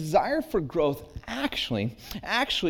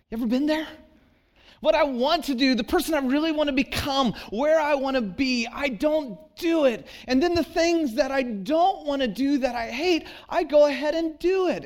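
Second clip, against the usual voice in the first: pitched much higher, fervent pulpit push; impassioned preaching; 10 semitones higher, 3 dB louder than usual. Acted. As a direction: lift the congregation with urgent fervour. Pastor climbs into urgent appeal.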